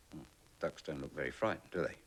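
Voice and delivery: ordinary delivery, nothing marked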